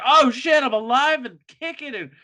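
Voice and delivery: high, loud startled joy; sudden overjoyed shout; excited revival. Retold or imitated. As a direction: jolt awake and babble happily. Revived dad voiced excitedly.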